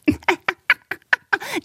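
high cackling kookaburra laugh; mocking bird laugh. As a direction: jeer with a gleeful bird cackle. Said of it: "hämisches Gelächter".

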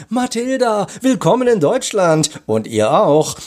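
high, loud, delighted greeting; exuberant welcome. A narrator's reading. Commissioner greets arriving friend at the door, exuberant.

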